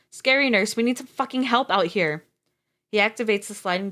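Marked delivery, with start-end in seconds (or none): 0.1-2.3 calling out loudly for help; a narrated cry for help. A narrator's reading. Mateo yelling for the nurse to help, a louder call. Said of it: "he cries out".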